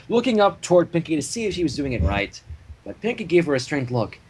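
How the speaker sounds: ordinary delivery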